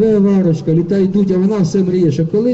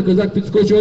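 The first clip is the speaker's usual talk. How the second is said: usual speaking voice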